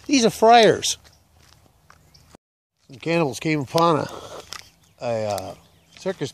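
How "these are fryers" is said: high, loud, slow exclamation; exasperated outburst. Acted. Cannibal chief scolding his cooks, in a high exasperated outburst. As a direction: drag it out in mock outrage.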